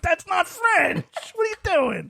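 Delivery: high, drawn-out mock protest; squeaky-high retold exasperation; exasperated disbelief. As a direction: call out a brother's nonsense incredulously. Retold exasperation at brother's fake French.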